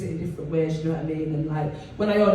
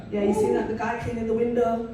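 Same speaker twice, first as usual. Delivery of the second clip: normal talking voice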